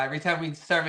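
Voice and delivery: usual speaking voice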